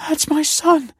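very high, shocked gasp; stunned mother catching her breath. A narrator's reading. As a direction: gasp it out, loud and startled. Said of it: "gasped the woman".

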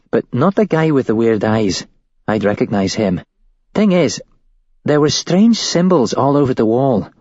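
a hint of a scared young woman; shaken woman witness. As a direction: voice her as a rattled witness telling it. Woman recounts the rescue.

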